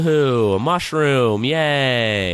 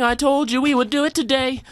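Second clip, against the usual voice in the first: normal talking voice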